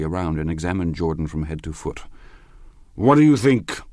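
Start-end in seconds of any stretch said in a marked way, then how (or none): 2.9-3.8 put-on high, loud, impatient question from a drunk; loud, high-pitched impetuous challenge; impetuous demand. A narrator's reading. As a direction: demand it abruptly, pitched well up. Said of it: "he demanded impetuously".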